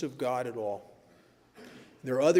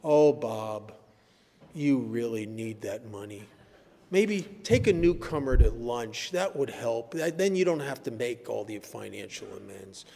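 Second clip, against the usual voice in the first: the seductive inner voice; 6 semitones lower than usual. Retold or imitated. Bob's addict thoughts tempting him, a lightly voiced low coax. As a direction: play the reasonable voice in his head talking him out of amends. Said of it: "this voice will say".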